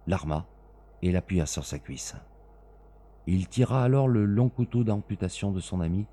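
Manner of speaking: ordinary delivery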